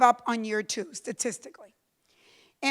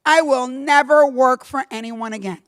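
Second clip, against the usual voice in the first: defiant resolve; 7 dB louder than usual. Retold or imitated. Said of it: "in my anger, I thought".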